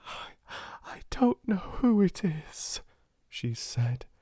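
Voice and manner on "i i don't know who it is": high, catching, tearful voice. A narrator's reading. Girl reading a friend's note, tearful. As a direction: stammer it through tears. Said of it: "burst into tears".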